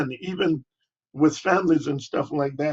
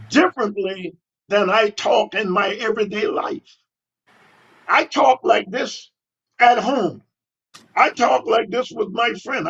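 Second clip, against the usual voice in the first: much higher, louder voice of a student pushing back; imitating a defiant student; 7 semitones higher, 3 dB louder than usual. Student tells teacher he talks this way everywhere, high and defiant imitation. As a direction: play the kid talking back to his teacher, raised and insistent. Retold kid answering his teacher.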